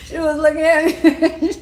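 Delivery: high laughter breaking into the words; laughing. Real, not acted. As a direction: laugh while telling it. Laughing while retelling.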